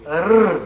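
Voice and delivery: single fluttering tongue r; teacher demo of r sound. Shown as a demonstration. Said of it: "like a flutter".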